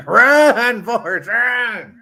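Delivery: high, long drawn-out yell; hammed-up film-quote shout. Joking at friend who'd run like Forrest, hammed-up urging shout. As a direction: holler it slowly, as if across a field. Quoting the film's famous shout.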